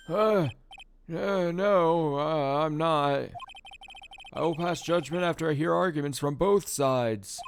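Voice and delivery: flustered judge. Acted. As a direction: stammer it high and flustered.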